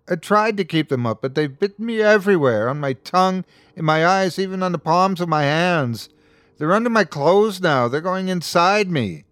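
high, hurried, distressed listing; panicked distress. A narrator's reading. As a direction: pour out mounting panic about the bugs. Panics about bugs on him.

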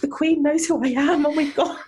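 high, laughing half-sob of disbelief; overwhelmed squeal. Retold or imitated. Recalling meeting [name], high laughing near-tears squeal. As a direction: squeal it like meeting your idol at last. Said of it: "And I was like".

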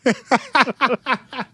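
high burst of laughter; real amused laugh; hearty laugh. Real, not acted. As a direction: crack up at your own story. Laughs at own story.

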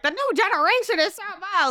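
high-pitched mock grumbling; mock old complainer. Mocking complaints about young people, high hammed-up whine. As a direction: imitate someone old griping, voice pushed up. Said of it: "I don't wanna sound old as fuck".

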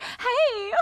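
very high bright "Hey"; put-on sunny greeting; fake cheerful. Acting cheerful for the doctor, very high. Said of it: "all of a sudden I was like".

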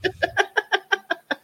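high laugh. Real, not acted. Laughing at the clip.

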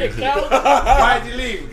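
laughing. Real, not acted. Mocking, laughing.

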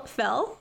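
"fell" for feel, with a short e; "fell", rhymed with bell; imitating her accent. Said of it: "the way she pronounces feel".

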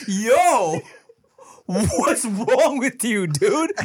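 high, drawn-out laughing protest; mock indignation through laughter. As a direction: act the appalled bystander while cracking up. Prank victims' shocked reaction, acted laughing.

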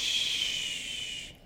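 long, hushed "shh"; slow soothing hiss of a shush; soft shush. Acted. Steve holds frantic Noah tight, softly shushing.

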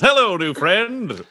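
high, drawn-out holler; yelled greeting. Acted. Adventurer kicking open a store door, hollering a greeting. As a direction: call it out long and high.

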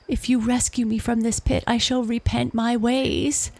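high, quick, wailing promise; howled plea. A narrator's reading. Trapped wolf begging for rescue, howling. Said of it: "howled the wolf".

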